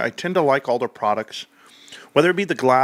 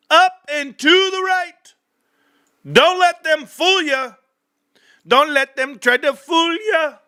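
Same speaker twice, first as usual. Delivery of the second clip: higher and louder hype chant; loud sing-song hype; hyped; 11 semitones higher, 4 dB louder than usual. Real, not acted. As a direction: pump up the crowd. Hyped chant about GameStop.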